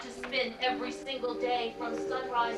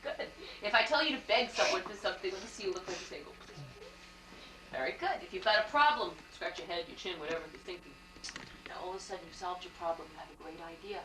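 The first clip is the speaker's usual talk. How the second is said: softer, lower voice giving mime cues; quiet instructions; 5 semitones lower, 7 dB quieter, faster than usual. Acted. Teacher softly cueing kids to mime silently, hushed. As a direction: hush the class as they mime.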